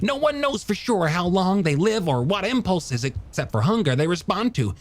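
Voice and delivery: high, animated, rhapsodic character voice; passionate young scientist. Acted. Scientist defending sharks to a doubter, high impassioned voice. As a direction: rush eagerly through the wonders, pitched well up.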